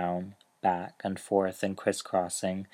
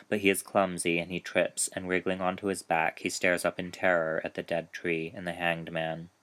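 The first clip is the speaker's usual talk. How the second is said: plain reading voice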